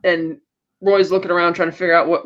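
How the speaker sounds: usual speaking voice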